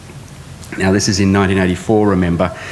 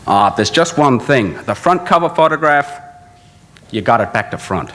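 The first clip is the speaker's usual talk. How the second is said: put-on Harry Seidler accent; 5 semitones higher than usual. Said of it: "having to do this accent".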